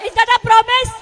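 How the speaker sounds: loud crowd-rousing question; rallying call. Real, not acted. Speaker demanding a promise from the crowd, loud call. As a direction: call it out for a big answer.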